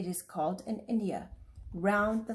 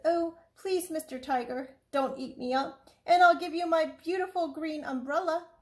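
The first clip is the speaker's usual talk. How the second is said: put-on lightly raised small boy's pleading voice; pleading little boy; 8 semitones higher than usual. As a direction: beg the tiger like a scared little boy. Child begging the tiger.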